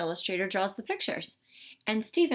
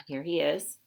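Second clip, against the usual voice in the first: ordinary delivery, nothing marked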